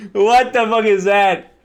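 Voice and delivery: incredulous outburst. Real, not acted. Reacting to the phonetic spelling, loud incredulous outburst. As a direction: stretch it out in loud disbelief.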